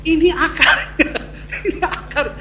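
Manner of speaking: laughing through the words. Real, not acted. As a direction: say it while chuckling. Breaks into laughter while insisting.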